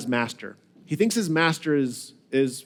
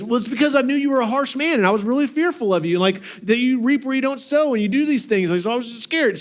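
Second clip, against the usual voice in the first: higher, fast whining; whiny excuse; 7 semitones higher, faster than usual. Retold or imitated. Servant making excuses to his master, whining. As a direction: make a feeble excuse. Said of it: "He goes".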